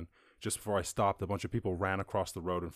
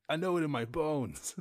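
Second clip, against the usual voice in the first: a hint of a seasoned elder, pitched up; lightly voiced old-timer; 7 semitones higher than usual. Ninety-year-old sharing hard-won wisdom, lightly imitated. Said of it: "literally the most everyday ninety-year-old".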